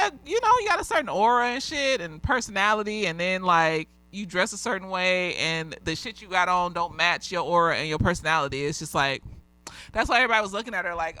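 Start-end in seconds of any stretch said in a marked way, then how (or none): none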